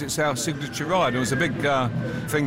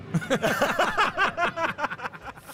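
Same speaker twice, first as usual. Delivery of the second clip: high laugh; 12 semitones higher, 3 dB quieter than usual. Real, not acted. Owner refuses ride, both laugh.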